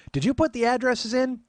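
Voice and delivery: high, loud imitated aunt; nagging aunt. Aunt checking on nephew's data entry, nagging voice.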